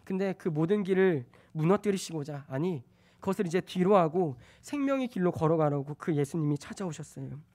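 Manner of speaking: ordinary talk, nothing marked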